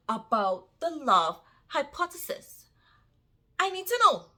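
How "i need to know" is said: sharp high burst, louder; desperate need. Real, not acted. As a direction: blurt it out like you can't wait another second. Eager rhetorical question about book.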